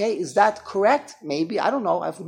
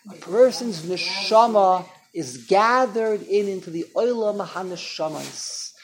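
usual speaking voice

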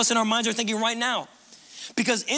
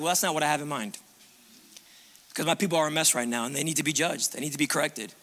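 much lower and softer, settled and firm; calm voice of God; 7 semitones lower, 5 dB quieter than usual. Acted. God answering Habakkuk, low, soft and firm. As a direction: speak as God, low and unhurried. Voicing God's stern answer.

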